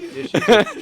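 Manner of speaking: laughing as he answers. Real, not acted. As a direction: laugh through the words. Laughing reply.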